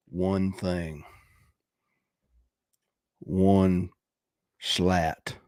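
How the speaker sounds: low, slow deadpan mimicry; imitating a clueless buyer, slowly; mocking a customer. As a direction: draw it out slowly, pitched low, in mockery. Mocking a stingy customer's plan.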